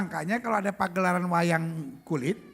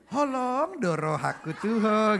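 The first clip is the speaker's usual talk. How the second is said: slow, stretched "Holong doro haku tuho"; over-sweetened; 4 dB louder, slower than usual. Shown as a demonstration. Comic showing the tone Bataks can't use, slow and sweet. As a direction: draw every vowel out syrupy. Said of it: "nggak bisa di".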